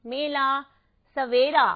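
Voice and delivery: ordinary talk, nothing marked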